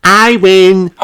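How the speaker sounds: high, loud triumphant piglet cry. A narrator's reading. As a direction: crow about winning. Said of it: "says Peppa".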